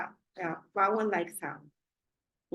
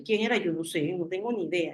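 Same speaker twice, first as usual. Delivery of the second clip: ordinary talk, nothing marked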